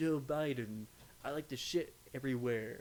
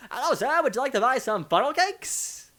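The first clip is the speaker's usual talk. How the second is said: much higher, loud pushy salesman voice; 12 semitones higher, 12 dB louder than usual. Retold or imitated. Selling at fairs, loud high salesman pitch. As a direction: pitch funnel cakes like a cheesy salesman. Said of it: "that's the only voice I can do".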